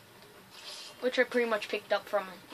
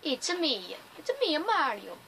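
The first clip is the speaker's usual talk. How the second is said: higher-pitched cartoon Italian accent; playful mock-Italian catchphrase; Mario; 6 semitones higher than usual. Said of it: "I can do an Italian".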